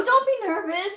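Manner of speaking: high, bright reassurance, imitating the interviewers; reassuring. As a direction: soothe a nervous candidate, pitched well up. Interviewers reassuring nervous candidate.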